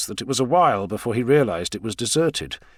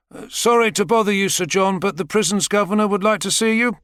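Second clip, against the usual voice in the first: high, louder polite announcement; apologetic prison escort; 10 semitones higher, 4 dB louder than usual. Acted. An escort summoning Sir John to the governor, polite and high. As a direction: deliver the summons politely, pitched up.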